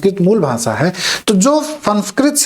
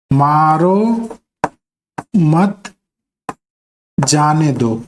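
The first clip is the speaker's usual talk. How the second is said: मारो, then मत, then जाने दो, each set apart; word-by-word reading; 3 semitones lower, much slower than usual. Shown as a demonstration. Teacher moves the comma to another place, word-by-word reading. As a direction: leave long gaps before and after मत. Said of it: "यही अल्प विराम दूसरी जगह लगाते हैं".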